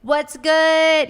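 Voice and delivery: loud, high mock greeting; switched-up hype voice. Shown as a demonstration. Podcaster showing what her videos can't be, loud and high. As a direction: belt out an over-bright greeting. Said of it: "now I can't be like".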